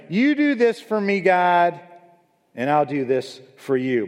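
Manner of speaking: high, pleading bargainer; mock bargaining. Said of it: "sounds like she's negotiating with God".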